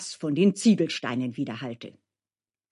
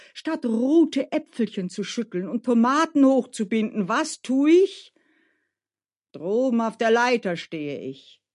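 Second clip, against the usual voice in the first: aggrieved gardener Grigori; 5 semitones higher, 4 dB louder than usual. Acted. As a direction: pour out your own grievance. (gekränkter Gärtner, klagend)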